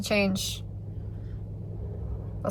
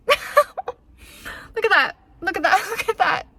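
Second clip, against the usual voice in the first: laughing; 6 semitones higher, slower than usual. Real, not acted. Laughing at her own outfit.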